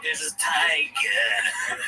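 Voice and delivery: very slow, drawn-out Dice imitation; mock comedian's stretched-out delivery; Dice impression. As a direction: drag each word out, mimicking Dice. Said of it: "when Dice does that kind of stuff".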